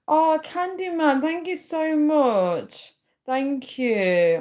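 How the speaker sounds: high, slow, gushing thank-you; beaming gratitude stretched out long; excited thanks. Real, not acted. Streamer gushing thanks to her longtime supporter, high and excited.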